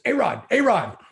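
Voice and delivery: trying to get his attention; Jerry calling out. Retold or imitated. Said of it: "He goes".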